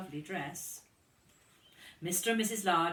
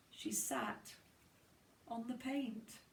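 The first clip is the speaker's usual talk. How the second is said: quiet aside; 6 semitones higher, 8 dB quieter than usual. Real, not acted. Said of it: "quiet aside to children".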